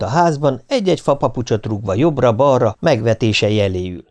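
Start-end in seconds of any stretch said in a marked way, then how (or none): none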